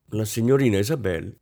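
plain reading voice

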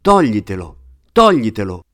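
a contained but forceful shout; narrated yell. A narrator's reading. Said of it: "ha cominciato a urlare".

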